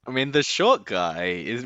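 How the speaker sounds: ordinary talk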